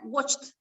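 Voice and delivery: "watched" ending 'cht', one syllable; final T sound. Shown as a demonstration. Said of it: "va a ser T". ("watched" con sonido final 't')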